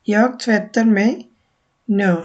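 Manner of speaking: jag tvättar mig på morgonen said very slowly, word by word; first slow model take; slow Swedish demo. Shown as a demonstration. Teacher modelling a morning example sentence, very slow. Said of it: "আমি এখন wash করতেছি".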